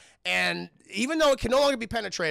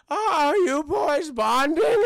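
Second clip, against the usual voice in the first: put-on high, slow, louder sweet old lady voice; mock old wife; 8 semitones higher, 9 dB louder, slower than usual. Streamer voicing the farmer's wife greeting the boys, high and sweet. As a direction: coo it like a doting farm wife.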